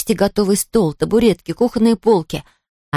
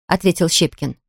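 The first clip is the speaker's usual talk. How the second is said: ordinary delivery, nothing marked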